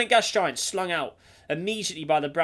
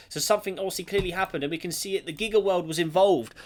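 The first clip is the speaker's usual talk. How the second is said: normal talking voice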